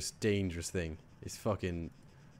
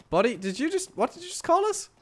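high-pitched, rising disbelief; mock affronted. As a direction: challenge them, pitch climbing in outrage. Affronted at being called British.

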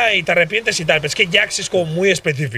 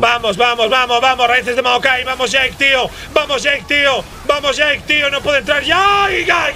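excited cheering; 7 semitones higher than usual. Real, not acted. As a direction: cheer the team on at full voice. Caster cheering a teamfight.

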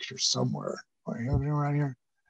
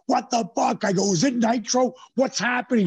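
higher, startled and lost; panicked confusion; 7 semitones higher than usual. Retold or imitated. Concussed wrestler asking his wife, panicked confusion. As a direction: blurt it out like you just woke up mid-match.

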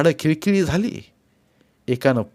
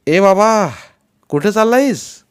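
louder, higher call from the truck; 7 semitones higher, 6 dB louder than usual. A narrator's reading. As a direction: hail the man on the road. Truck driver calls out. (ट्रकवाला हाक मारतो, मोठ्याने)

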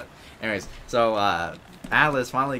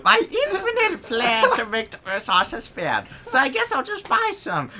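villain voice; 12 semitones higher than usual. Acted. Villain explaining his scheme, hammed up. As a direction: ham up a cartoon villain.

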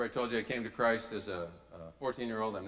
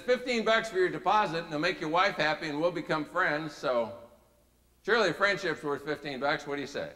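animado; 5 semitones higher, 6 dB louder than usual. Retold or imitated. Recruiter's pitch, acted.

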